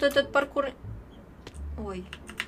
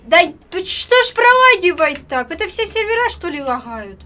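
frustrated outburst; 3 semitones higher, 13 dB louder than usual. Real, not acted. Angry at lagging game.

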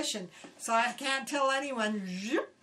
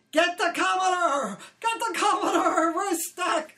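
high, loud and panicked; yelling; 7 semitones higher, 6 dB louder than usual. Retold or imitated. Officer on the bridge yelling at her to fetch the Commodore. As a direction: bark the order over the waves. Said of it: "he yells at me".

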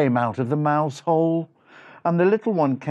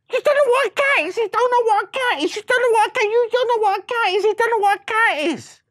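very high, loud, frantic repetition; shocked outcry; 6 dB louder than usual. Acted. Mouse brothers aghast at Freddy's question, shocked. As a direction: squeal it over and over in disbelief.

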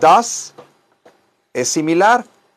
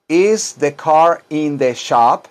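slow model question; much slower than usual. Shown as a demonstration. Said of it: "teacher models the question".

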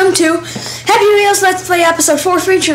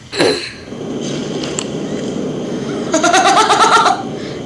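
breathless stunned laugh; shocked laughter; 4 semitones higher than usual. Real, not acted. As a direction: laugh in disbelief. Wild level reveal.

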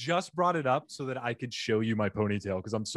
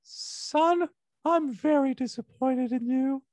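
much higher voice doing Winnie the Pooh as mom; 13 semitones higher, slower than usual. Pretend. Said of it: "Can you do an impression of your mom".

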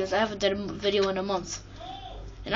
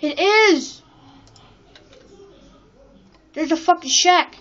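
excited shout; 7 semitones higher, 7 dB louder, slower than usual. Real, not acted. Finding the place again.

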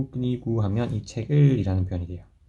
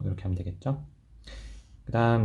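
ordinary delivery

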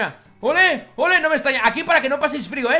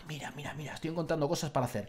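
usual speaking voice

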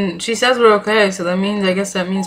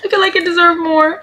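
higher, pleading mock fright; hammed-up fear; 7 semitones higher than usual. Patient hamming up fear of dying to doctor, higher pleading voice. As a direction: play a scared patient begging not to die. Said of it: "I'm such a good actor".

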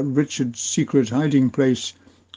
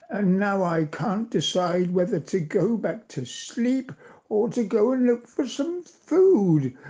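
higher put-on voice of a grumpy badger; a sleepy badger complaining in a whiny higher voice; grumbly badger; 6 semitones higher than usual. A narrator's reading. Gary the badger fussing about food, higher grumbly voice. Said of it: "said Gary in a very grumbly voice".

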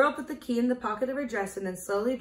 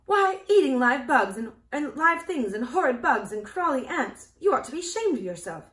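higher, louder reproach; horrified telling-off with raised pitch; appalled scolding; 5 semitones higher, 7 dB louder than usual. A narrator's reading. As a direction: scold in shocked disgust. Dorothy scolds hen for eating bugs.

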